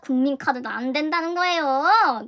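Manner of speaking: loud and slow, dragged-out ending; exasperated complaint. Retold or imitated. Last card refused for the train ticket, drawn-out exasperation. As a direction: wail the last word in exasperation.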